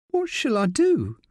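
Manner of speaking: high, small lost question; lost and alone. A narrator's reading. Sally asking Rick what to do, lost and alone. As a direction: ask as if nobody is left. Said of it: "She sounds lost, alone".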